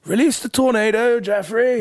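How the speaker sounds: very high, loud God voice; gleeful command. Retold or imitated. God orders Jeffrey to wreck the city, gleeful. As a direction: cry out the order with relish.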